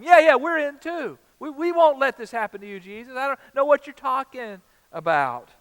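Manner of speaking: high-pitched hammed-up chorus of disciples; eager disciples. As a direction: play the overeager sidekicks piling on.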